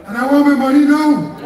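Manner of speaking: hammed-up arrogant politician; mocking Keith Rowley. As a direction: throw his boast back at him with scorn. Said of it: "In the most arrogant, disrespectful style".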